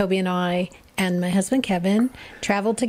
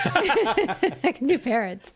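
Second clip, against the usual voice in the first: parrot voice; 4 semitones higher, slower than usual. Pretend. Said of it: "I could be the parrot".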